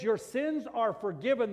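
"sins" hit hard in a loud, high proclaiming voice; preached proclamation. Acted. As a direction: proclaim "Your sins are forgiven" to the crowd. Preaching Jesus' words loudly.

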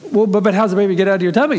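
put-on high, fast, insistent little-kid voice; Paige. Little girl asking how baby gets out, insistent. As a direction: press like a persistent child, stammering quickly.

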